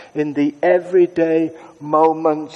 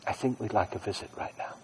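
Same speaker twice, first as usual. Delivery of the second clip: even softer, almost whispered; fading whisper; 8 semitones lower, 17 dB quieter, faster than usual. Shown as a demonstration. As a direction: let it fade to a whisper. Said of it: "I say under my breath".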